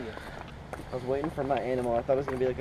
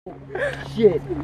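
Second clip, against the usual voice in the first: very high, loud shriek; excited shriek; 7 dB louder than usual. Real, not acted. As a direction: shriek in disbelief. Hawk diving at duck.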